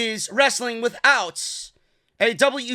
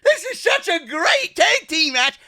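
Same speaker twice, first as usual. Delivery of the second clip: high-pitched mock fan gushing; mock a gullible fan's delight; sarcastically overexcited fan voice, pitched way up; 8 semitones higher, 4 dB louder than usual. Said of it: "fucking plebeians out there".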